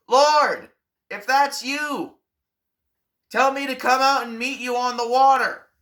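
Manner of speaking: high, loud call to Jesus over the storm; shouting. Acted. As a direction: yell it out across the waves. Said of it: "shouts out".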